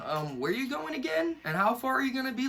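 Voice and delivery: high, fast nagging parent voice; worried parent. Retold or imitated. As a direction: mimic an anxious mum checking up.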